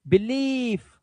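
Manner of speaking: बिलीफ with a stretched ee; long ee. Shown as a demonstration. Teacher showing students the long vowel. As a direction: lengthen the ee in बिलीफ. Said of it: "लंबा लेना है".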